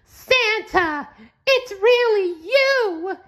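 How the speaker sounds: high, slow squeaky cartoon sponge voice; high-pitched SpongeBob voice, amazed; excited SpongeBob gasp. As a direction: gasp it out in wide-eyed wonder as SpongeBob. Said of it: "SpongeBob gasped".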